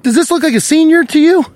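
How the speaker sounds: high, indignant question; incredulous challenge. Retold or imitated. Customer to man claiming a senior coffee, incredulous. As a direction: throw the question at him in high disbelief.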